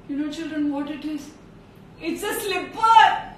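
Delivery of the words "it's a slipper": slow, loud, high exclamation; shocked reveal. A narrator's reading. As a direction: land the punchline in horrified surprise. Storyteller reveals what is served.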